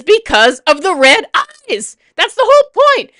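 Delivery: building to a high, loud cry; exasperated outburst. Real, not acted. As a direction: climb into an exasperated yell. Exasperated rant about red eyes.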